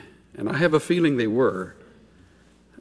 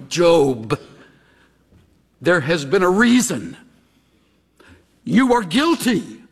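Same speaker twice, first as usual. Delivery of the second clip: accusing. Acted. Friends accuse Job.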